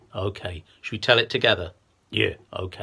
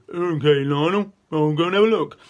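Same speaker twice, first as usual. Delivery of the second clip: hippo voice; 6 semitones higher, 6 dB louder than usual. Acted. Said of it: "Henry said".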